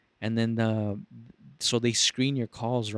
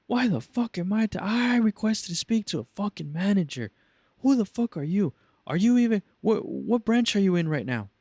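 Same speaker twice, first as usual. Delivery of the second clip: mock furious caller; 9 semitones higher than usual. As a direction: blow up at the agent.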